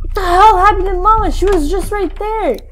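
high, loud startled outcry; real alarm. Real, not acted. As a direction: cry out in shocked disbelief. Mama suddenly vanished.

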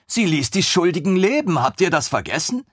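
put-on high, bright-eyed young officer, gushing; a thrilled lieutenant who can't believe his luck; eager lieutenant voice. Lieutenant gushing over the queen's griffin, high and eager.

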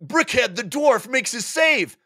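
high, slow dwarf player; gruff player claim. Acted. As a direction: announce the dwarf's save proudly. Players announcing saves.

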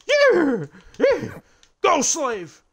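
very high, loud riding yells; shrill, hammed-up giddy-up shouts; urging yell. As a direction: whoop and yell to drive a mount on. Yelling to drive horse on.

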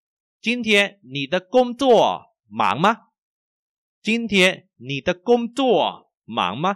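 slow, high, carefully toned question; language teacher model reading. Shown as a demonstration. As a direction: spell out every tone clearly. Models sentence for learners. (đọc mẫu chậm, rõ từng thanh)

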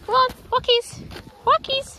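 high sing-song call to the dog; calling walkies. Real, not acted. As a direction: sing out walkies to tempt him. Urging dog for walk.

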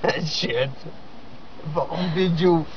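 ordinary talk, nothing marked

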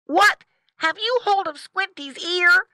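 high, loud startled piglet; squeaky outraged question; shocked boy piglet. Acted. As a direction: demand an answer as a young pig.

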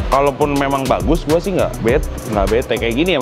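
ordinary talk, nothing marked

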